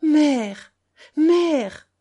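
high, loud, drawn-out moan; plaintive wail. A narrator's reading. Grandfather on his knees calling for grandmother, plaintive. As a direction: wail for help pitifully. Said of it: "Il geignit encore d'une voix plaintive".

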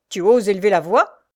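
ironic challenge. Acted. Jacques facing his rebellious brother, ironic. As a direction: challenge him with loud irony. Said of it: "lui dit ironiquement".

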